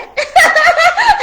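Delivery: high, loud delighted laugh; real shocked laugh; burst of laughter. Real, not acted. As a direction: crack up in disbelief. Stunned joy at news.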